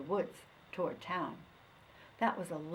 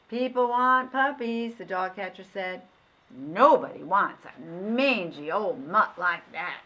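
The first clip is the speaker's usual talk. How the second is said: dismissive dog catcher; 8 dB louder than usual. Acted. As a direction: shrug off the old mutt.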